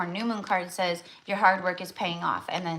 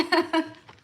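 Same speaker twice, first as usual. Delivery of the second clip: short laugh; 9 semitones higher than usual. Real, not acted. Laughing at own joke.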